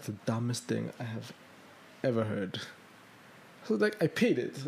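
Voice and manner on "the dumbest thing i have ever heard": soft, low, spaced-out disbelief; quietly appalled thought, dropped low; inner thought. Retold or imitated. Recalling a private reaction to the tax, inner thought. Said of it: "my head was like".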